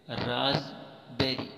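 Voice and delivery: "रास्पबेरी" slow, as raaz, pause, beri; correct pronunciation. Shown as a demonstration. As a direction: say "रास्पबेरी" slowly with Z and no P. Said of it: "राज इकट्ठा पढ़ेंगे". ("रास्पबेरी" धीरे, राज़-बेरी)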